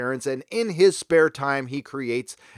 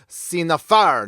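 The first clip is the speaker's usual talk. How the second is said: slow, louder hammed-up French accent; mock French accent; 2 semitones higher, 5 dB louder, slower than usual. Pronouncing a legal term for viewers, exaggerated French accent. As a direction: draw it out in a showy fake French accent. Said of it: "let me use my best French accent".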